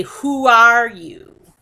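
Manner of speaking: loud, slow confrontational question; rude demand. Acted. Speaker confronts a listener about who they are, rudely. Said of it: "point my finger at you very rudely and say".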